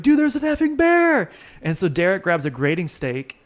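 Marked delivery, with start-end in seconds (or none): 0.0-1.3 high loud yell of alarm; excited shout. Retold or imitated. Teen spots a bear outside the car, alarmed shout. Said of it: "I'm like".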